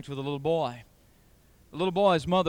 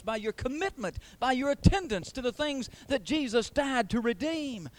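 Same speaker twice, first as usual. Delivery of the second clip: high, emphatic pulpit challenge; exhorting preacher; 8 semitones higher than usual. Real, not acted. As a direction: challenge the congregation with ringing, raised pitch. Preacher exhorts at raised pitch.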